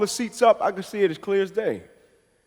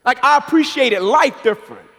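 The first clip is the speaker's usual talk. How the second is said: ordinary talk, nothing marked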